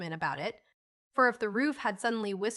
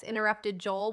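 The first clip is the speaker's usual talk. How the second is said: plain reading voice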